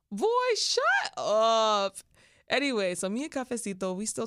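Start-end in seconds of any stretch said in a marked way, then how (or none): 0.1-2.0 very slow, louder, teasing drag of the words; drawn-out playful sass. Real, not acted. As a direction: stretch it out like you're flattered and mocking him. Playful dismissal of his text.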